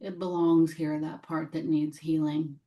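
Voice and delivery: measured meditation voice, long silences between phrases; gently invite, leaving room after each phrase; guided meditation. Real, not acted. Guide welcoming what needs healing, gentle meditation voice.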